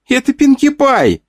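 put-on high, slow child's cry of delight; excited little girl shout. A narrator's reading. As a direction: squeal it like a thrilled little girl. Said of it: "закричала она".

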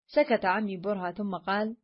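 usual speaking voice

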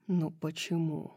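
low, hushed murmur; softly whispered question. A narrator's reading. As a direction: murmur it low and soft, as in a lover's ear. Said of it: "прошептал он".

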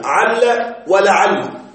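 عل then لعل, said slowly and distinctly; two forms of لعل. Shown as a demonstration. Teacher showing students the forms عل and لعل, slow and clear. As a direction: spell out عل and لعل slowly, syllable by syllable. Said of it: "تستعملها باللام الأولى وبدونها".

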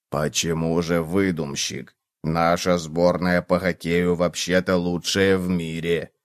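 low, slow, soft bug; quiet low plodding cockroach voice; mildly protesting cockroach. A narrator's reading. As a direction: object calmly, low and unhurried.

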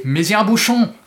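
mock aggressive jeer; vulgar yell. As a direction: hurl it crudely across the room. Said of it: "hurlait avec une vulgarité agressive".

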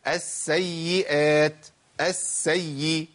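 السيئات slow, with s and y each held; weighty, drawn-out s and y in السيئات، السيء; full doubling. Shown as a demonstration. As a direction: stretch both doubled letters in السيئات and السيء. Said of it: "لازم أقول".

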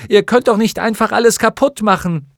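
high, loud protest; shouting. A narrator's reading. Bastian protests at the wrecking, shouting. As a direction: yell in outraged protest. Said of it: "schrie Bastian".

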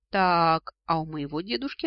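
plain reading voice

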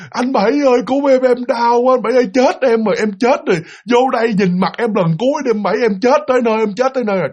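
wife begging again. Retold or imitated. Dying wife wailing for her husband to come in. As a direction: plead as if dying, calling him in. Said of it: "the wife's voice crying again".

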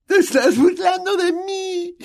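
very high, slow, crying voice; on the edge of tears; tearful. A narrator's reading. Myrtle accusing Hermione, tearful and high. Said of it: "unas lágrimas plateadas asomaron".

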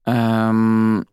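long, stalling filler sound; drawn-out hesitation; hesitant. A narrator's reading. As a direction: hum it out, unsure what to say. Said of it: "замялся тот".

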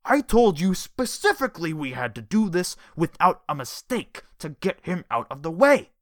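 loud, high furious scolding; rising almost shrill. Acted. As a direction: build up into a shrill rant. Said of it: "The voice rose until it was almost shrill".

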